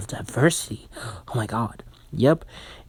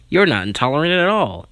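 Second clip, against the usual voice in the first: loud sarcastic punchline, fast; 5 semitones higher, 5 dB louder, faster than usual. Acted. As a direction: throw it out with mock sincerity, louder.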